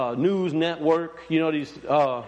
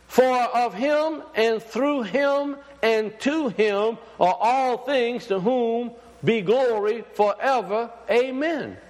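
slow, higher, ringing scripture proclaimed; raised preaching voice, drawn out; preached scripture reading; 5 semitones higher, slower than usual. Acted. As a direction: proclaim the verse slowly to the congregation. Reads the Romans verse aloud.

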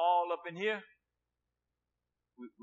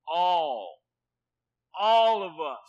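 "All" punched out loud and held alone; emphatic ALL; 9 dB louder, much slower than usual. Real, not acted. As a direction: hit "All" hard, louder each time. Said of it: "Why I'm emphasizing this word for all".

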